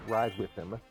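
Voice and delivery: usual speaking voice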